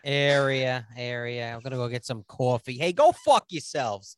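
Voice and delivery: hammed-up New York accent. Guest plays up his teased New York accent. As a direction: lean into a New York accent, ending in a loud outburst. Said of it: "There's the New York coming out of you".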